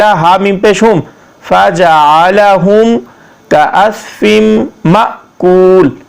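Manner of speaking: letter-by-letter spelling with slow recited blends; Arabic reading lesson; Quranic spelling drill. Shown as a demonstration. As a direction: spell each letter, then chant the blend slowly.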